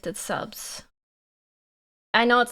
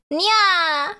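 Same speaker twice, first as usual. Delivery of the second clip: high, loud cat nya; cat girl nya; 8 semitones higher, 4 dB louder than usual. Pretend. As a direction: pounce on the nya like a catgirl. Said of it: "Okay, here, Clincher".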